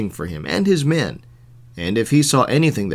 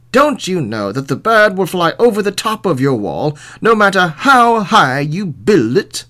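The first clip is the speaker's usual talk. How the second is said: raised, loud incredulous question; scolding sheriff; 6 semitones higher, 5 dB louder than usual. A narrator's reading. Sheriff correcting the wall builders, raised and incredulous. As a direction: press the point high and loud.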